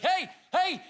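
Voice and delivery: high-pitched angry hailing; mock a lollipop lady yelling in your face; sharp, high calls; imitated shouting. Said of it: "she's shouting at us".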